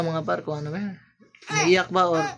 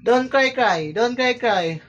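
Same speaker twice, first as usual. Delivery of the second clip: high, loud coaxing sing-song; 9 semitones higher, 5 dB louder, slower than usual. Real, not acted. Father coaxing his baby to smile on camera, high baby talk.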